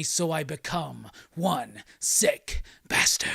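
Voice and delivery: hammed-up supervillain monologue, slow and theatrical; One Sick Bastard. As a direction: declaim it slowly, swelling and dropping for drama. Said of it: "so then there's this monologue".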